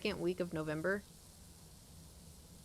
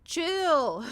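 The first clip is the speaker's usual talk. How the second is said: rising, louder exasperation; exasperated chill; 3 semitones higher, 6 dB louder, much slower than usual. Retold or imitated. Player telling the merchant to chill, exasperated. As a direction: tell him to calm down, fed up. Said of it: "And I'm like".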